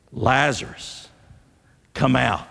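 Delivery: authoritative command. Acted. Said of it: "with great authority in his voice".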